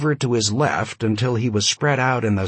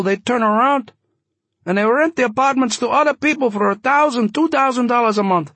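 put-on high, loud voice, light Yiddish accent; slight Yiddish accent; 14 semitones higher, 5 dB louder than usual. A narrator's reading. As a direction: press him in a high Yiddish-tinged voice. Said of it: "slightly Yiddish accent".

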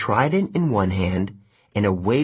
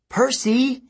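high, urgent yell. A narrator's reading. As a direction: call out in warning. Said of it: "Annabeth yelled at me".

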